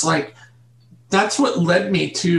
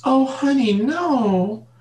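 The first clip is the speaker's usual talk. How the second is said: higher-pitched imitation of mom; mother's voice; 5 semitones higher than usual. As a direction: imitate a mother gently correcting her child. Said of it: "And my mom goes".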